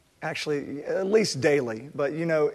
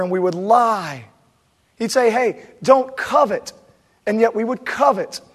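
louder, higher impassioned sermon voice; preaching at full force; 8 semitones higher, 9 dB louder than usual. Acted. Pastor preaching hard to the congregation about pride, impassioned. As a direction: drive it home from the pulpit.

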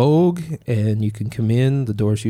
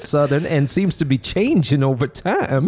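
put-on higher, drifting Southern-ish accent; 5 semitones higher than usual. Host puzzled by his own silly accent, put-on Southern-ish accent. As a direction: keep up a shaky attempt at a Southern accent. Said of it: "I don't know why I'm using this accent".

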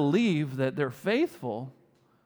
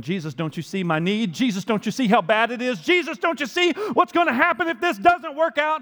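rising, louder, ever higher begging; desperate pleading; 7 semitones higher, 5 dB louder than usual. Retold or imitated. A believer begging Jesus about their need, building panic. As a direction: build from worried to frantic. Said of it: "we going".

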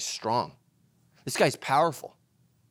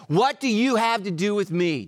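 half-acted cry of the possessed man; a touch of the demoniac's cry; 5 semitones higher than usual. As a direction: voice pushed higher and louder, crying out. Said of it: "crying out with a loud voice, he said".

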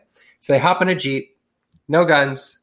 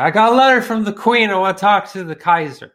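put-on drunk bro demand; 7 semitones higher, 5 dB louder than usual. Drunk colonel demanding to see Kaiser.

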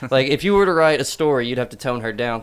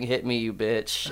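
normal talking voice

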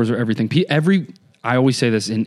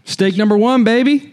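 high, loud playful cheer; excited shout; 11 semitones higher, 6 dB louder than usual. Real, not acted. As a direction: celebrate the mistake gleefully. Celebrating own slip-up.

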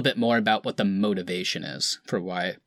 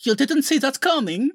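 much higher, hammy fake Russian accent; Aaron Taylor Johnson's Russian accent; 3 dB louder than usual. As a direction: overdo a bad Russian accent as Pietro. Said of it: "made up their really terrible Russian accents".

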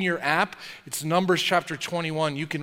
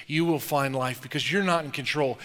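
ordinary delivery

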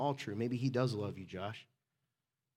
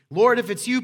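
high, loud cry across the water; calling out; 6 semitones higher, 11 dB louder than usual. Acted. Peter calls to Jesus from the boat, calling out. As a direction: call out urgently to someone far off.

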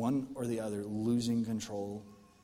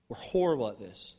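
usual speaking voice

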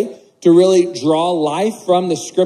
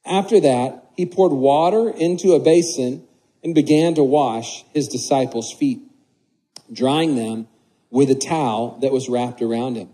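scripture reading; 3 semitones lower than usual. Acted. Reads the scripture passage aloud.

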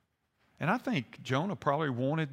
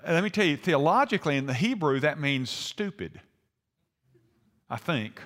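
normal talking voice

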